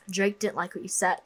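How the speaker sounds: ordinary talk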